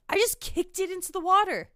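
loud, high-pitched mock confession; joking confession. Retelling Mike announcing he kicked the map, joking boast. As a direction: blurt it out high and loud, not serious. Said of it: "it's not serious. Like, he's just joking".

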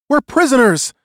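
very high, loud cry of a captive; shrill it out urgently. A narrator's reading. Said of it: "he heard in a shrill voice".

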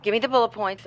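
loud impatient order; brisk demand. Acted. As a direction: bark it out briskly. Busy grandmother demanding summary.

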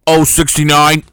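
booming caller voice into a mic; bingo caller. Pretend. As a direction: call the number like a bingo hall MC. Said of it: "pretend to be the bingo caller".

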